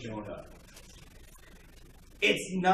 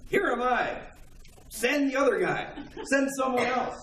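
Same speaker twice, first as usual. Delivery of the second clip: lightly voiced, higher dodging reluctance; reluctant Jonah; 8 semitones higher than usual. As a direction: play Jonah passing the job off, pitched up. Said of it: "his attitude was the exact opposite".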